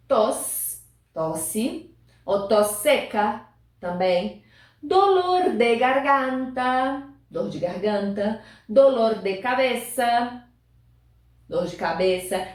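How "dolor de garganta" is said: slow model phrase. Shown as a demonstration. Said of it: "teacher models Spanish then Portuguese".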